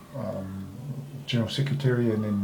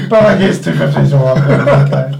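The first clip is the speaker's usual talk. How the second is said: louder, laughing it off; chuckling through the words; 4 semitones higher, 13 dB louder than usual. Real, not acted. Laughing about the smaller contract.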